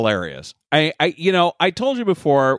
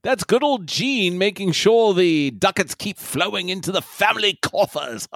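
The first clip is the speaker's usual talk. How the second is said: put-on British accent. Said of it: "some British douchebag".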